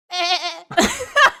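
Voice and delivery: bleating like a ram; high nasal goat bleat. Acted. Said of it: "more accurate to the goat lifestyle".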